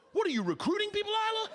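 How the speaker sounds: high-pitched, rapid-fire disbelief; incredulous outburst. Retold or imitated. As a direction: demand it like an alarmed dad, voice shooting up. Dad's incredulous outburst at daughter.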